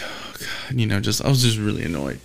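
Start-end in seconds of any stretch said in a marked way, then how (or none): 0.0-0.8 exasperated sigh. Retold or imitated. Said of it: "I was just like".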